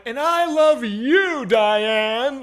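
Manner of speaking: loud, high, drawn-out mocking sing-out; teasing. Eight-year-old teasing his friend about his mom, mocking. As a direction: belt it out to tease a friend. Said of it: "kind of teasing him".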